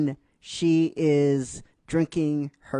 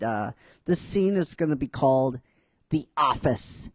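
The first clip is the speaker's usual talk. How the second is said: ordinary talk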